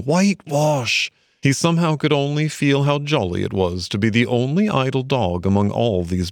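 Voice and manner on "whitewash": lightly voiced, slow nagging conscience; a conscience prodding him. A narrator's reading.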